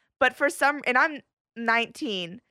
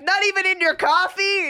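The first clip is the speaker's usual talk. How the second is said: high, mock distant call; calling after someone; 7 semitones higher, 3 dB louder than usual. Vanilla seller calls after departing customer, mock holler. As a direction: imitate a vendor hollering down the market. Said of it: "two hundred feet down".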